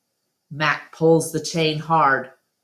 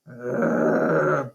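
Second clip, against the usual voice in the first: snarl; 2 semitones lower than usual. A narrator's reading. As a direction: growl it like an angry dog. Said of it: "Bob snarls".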